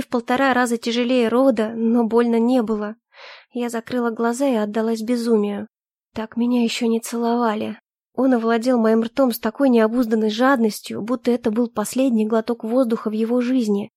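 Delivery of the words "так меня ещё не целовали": plain reading voice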